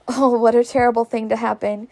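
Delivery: ordinary delivery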